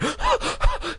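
high breathy gasps; noisy panting. Acted. Sister struggling with the sticky mask, noisy panting. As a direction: pant hard, acted. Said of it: "She was panting noisily".

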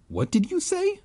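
high-pitched, startled demand. A narrator's reading. Narrator demands answer from chanting boy, demanding. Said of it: "I demanded".

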